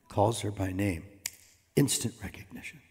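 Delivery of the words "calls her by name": usual speaking voice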